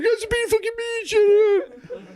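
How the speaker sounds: very high, whining fake sobs; mock crying. Crying drunk complaining to police, exaggerated tearful wail. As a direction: bawl it out, hammed up and self-pitying. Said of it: "And I cried".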